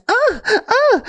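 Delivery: very high, repeated moans; high hammed-up moaning; mock sexual moans. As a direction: imitate exaggerated moaning from a film. Imitates porn in background.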